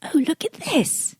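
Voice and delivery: excited whisper. Acted. Player excitedly whispers at the find.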